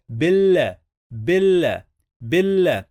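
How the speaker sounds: BIL said with a held double l, then a short a; doubled l. Shown as a demonstration. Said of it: "we pronounce the letter two times".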